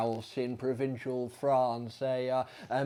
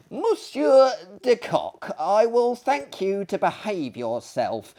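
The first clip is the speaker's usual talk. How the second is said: put-on higher, scolding old lady; old lady chiding her kicking neighbour; indignant old lady; 7 semitones higher, 4 dB louder than usual. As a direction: scold him like a prim old dame.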